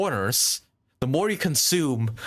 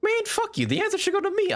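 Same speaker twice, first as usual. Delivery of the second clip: mock outrage; faster than usual. Mock outrage at losing the point.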